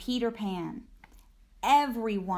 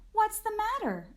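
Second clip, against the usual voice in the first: much higher, caring question; concerned Ma; 12 semitones higher than usual. A narrator's reading. Ma asking her sad daughter, concerned and high. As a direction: ask gently, pitched well up.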